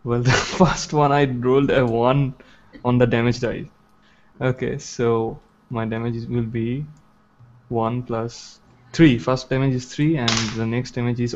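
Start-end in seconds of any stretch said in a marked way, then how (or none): none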